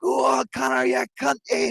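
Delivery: high-pitched jeering wee man, egging Conor on; cheeky wee man. Retold or imitated. Wee man shouting from behind the house, high mock jeer. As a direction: squeal it up like a cheeky wee lad heckling. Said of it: "and the wee man goes".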